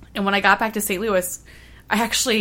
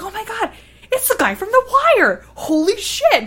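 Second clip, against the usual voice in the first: much higher and louder, gushing; starstruck squeal; 9 dB louder than usual. Retold or imitated. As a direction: freak out like a fan seeing a celebrity. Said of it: "but I was like".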